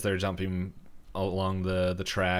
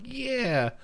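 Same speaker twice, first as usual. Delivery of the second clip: high-pitched mock cheer; a quick high whoop, retold; imitated cheer. Group cheers Stan's climb, high imitated cheer. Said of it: "and they're like".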